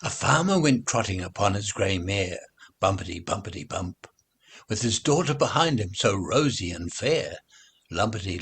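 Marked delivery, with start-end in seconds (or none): none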